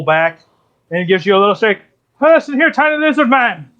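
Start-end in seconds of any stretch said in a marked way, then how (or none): 2.2-3.7 high-pitched, hearty bear voice; affectionate bear. Acted. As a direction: bear heartily praising his new drinking buddies. Tipsy bear praises the adventurers.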